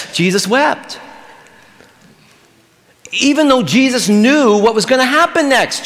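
ordinary talk, nothing marked

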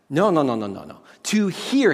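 normal talking voice

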